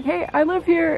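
high-pitched mock call-out; mock yell. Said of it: "I yell at them".

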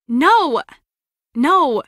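firm loud refusal; emphatic refusal. Acted. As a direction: shut it down firmly, one word at a time.

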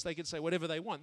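ordinary talk

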